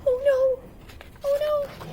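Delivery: high, loud hammed-up dismay; mock alarm. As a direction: gasp out in mock horror. Narrator reacts dramatically.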